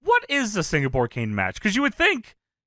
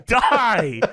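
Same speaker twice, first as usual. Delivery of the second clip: high, loud hammed-up heckling; mock angry shout; 7 semitones higher, 4 dB louder, much slower than usual. Crowd turning on a shitty match, mock angry shouting. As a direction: heckle like a bloodthirsty fan, playfully.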